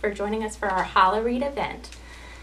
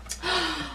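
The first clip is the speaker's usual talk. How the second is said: soft, airy storybook gasp; mock surprised gasp; 7 semitones higher, 4 dB quieter than usual. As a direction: gasp softly in pretend surprise. Mock surprise before each reveal.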